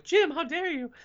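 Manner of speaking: high hammed-up indignation; mock outrage. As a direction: play up the shocked family. Mock outraged family quote.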